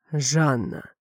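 a hint of a heavy chest voice; low chesty cook. A narrator's reading. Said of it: "Голос у неё оказался низким, грудным".

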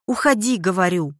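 exasperated order. A narrator's reading. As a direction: order her away, losing patience. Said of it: "в сердцах воскликнула женщина". (сердитый громкий приказ)

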